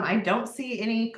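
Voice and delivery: normal talking voice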